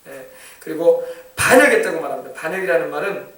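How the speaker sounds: ordinary delivery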